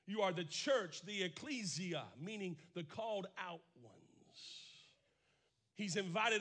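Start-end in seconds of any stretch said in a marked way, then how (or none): none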